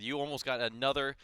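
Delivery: ordinary talk, nothing marked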